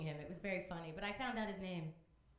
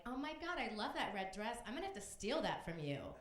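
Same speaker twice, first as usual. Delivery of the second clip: ordinary delivery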